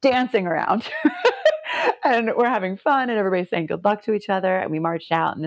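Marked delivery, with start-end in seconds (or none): 0.9-2.0 much higher burst of laughter; a delighted giggle; laughing warmly. Real, not acted. Laugh recalling dancing.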